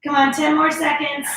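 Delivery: energetic trainer counting down a hold; fitness coach hype. Acted. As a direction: push the class through the burn. Pumping up class during hold.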